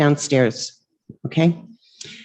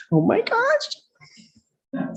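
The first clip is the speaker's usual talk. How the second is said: surprised; 4 dB quieter, much slower than usual. Real, not acted. Surprised reaction, mask coming off.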